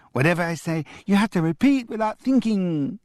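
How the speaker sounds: put-on high parroting little boy; childish echo. A twin parroting grandfather's rule, high and squeaky. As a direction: copy grandad in a squeaky kid voice.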